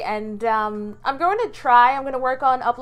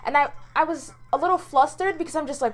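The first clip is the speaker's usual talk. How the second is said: ordinary talk, nothing marked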